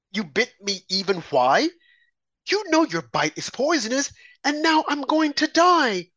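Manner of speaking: high, desperate pleading; distressed woman. A narrator's reading. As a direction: beg as if dying from the bite. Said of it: "cried that woman".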